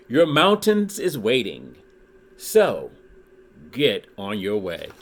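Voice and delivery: lively read-aloud with rhyming bounce; storybook reading. Acted. Reading a Dr. Seuss book to his YouTube viewers, lively storytelling. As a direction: read it like a children's picture book.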